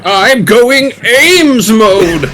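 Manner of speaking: slow, high, stretched-out hype call; drawn-out hype. Pretend. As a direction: stretch it out like a battle cry. Joking about the game character.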